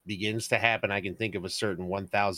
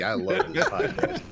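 high, laughing irony; 9 semitones higher than usual. Real, not acted. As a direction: laugh out the praise. Laughing ironic praise.

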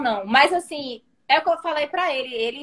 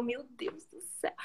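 1 whispered gasp; 14 dB quieter than usual. Retold or imitated. Stunned reaction to proposal.

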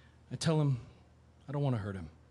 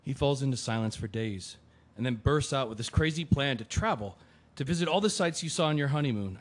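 usual speaking voice

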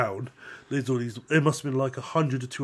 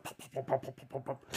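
engine popping; 11 dB quieter than usual. Retold or imitated. Imitating engine rattling.

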